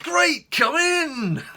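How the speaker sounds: very high, loud, stretched-out welcome; forced enthusiasm. Retold or imitated. Buzzing the courier in, with mock enthusiasm. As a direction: keep the jolly act going. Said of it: "So I was like".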